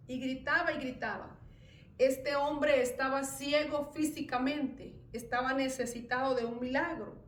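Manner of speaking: ordinary talk, nothing marked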